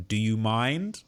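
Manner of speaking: usual speaking voice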